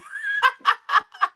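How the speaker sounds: loud, high-pitched cackle; shrieking peals of laughter. Real, not acted. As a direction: burst out laughing at being teased. Teased about her missing glasses.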